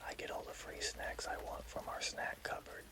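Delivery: plain reading voice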